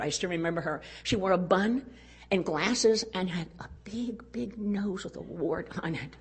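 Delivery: usual speaking voice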